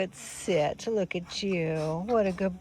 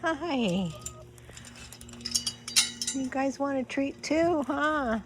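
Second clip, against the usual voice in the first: talking to animals; 5 semitones higher, slower than usual. Real, not acted. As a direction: coo at the animals. Greeting animals high slow.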